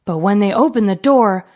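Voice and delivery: plain reading voice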